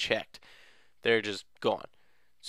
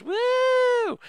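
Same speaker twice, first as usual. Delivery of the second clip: a very high whooping cheer; excited cheer; 4 dB louder than usual. Real, not acted. Cheering after rescuing sisters.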